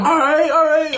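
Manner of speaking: high-pitched, hurried-sounding plea; mock panicked surrender. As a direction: give in with an acted high yelp. Acting out his pleading in car.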